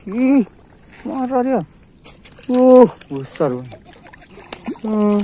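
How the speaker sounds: high, loud yelps as the fish fights; loud, high excited cries; excited shouts. Real, not acted. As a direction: squeal with the thrill of a strong fish. Fish pulling hard, fighting.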